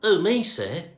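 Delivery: usual speaking voice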